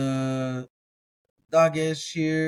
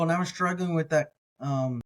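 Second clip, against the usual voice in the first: normal talking voice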